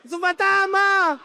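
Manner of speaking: high, loud, drawn-out cry of terror; full-voiced mock panic scream; frightened shout. Shown as a demonstration. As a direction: yell it like panicked men seeing a ghost. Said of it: "a la cuenta de tres vamos a gritar".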